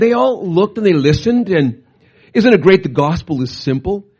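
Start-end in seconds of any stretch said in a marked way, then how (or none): none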